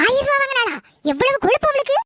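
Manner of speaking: indignant outburst. Acted. Mother to son about the thousand rupees, indignant and high. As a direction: explode at the neighbour's nerve.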